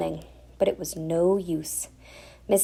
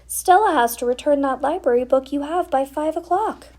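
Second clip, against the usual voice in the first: urgent call; 8 semitones higher, 5 dB louder than usual. Acted. Duff urgently calling.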